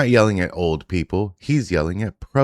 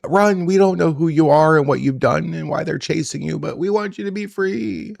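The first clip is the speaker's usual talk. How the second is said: mock cheering; 9 semitones higher than usual. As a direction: egg him on with fake enthusiasm like the woman in the clip. Host mockingly cheers fleeing man.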